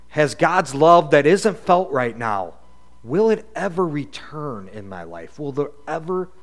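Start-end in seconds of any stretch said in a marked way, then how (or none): none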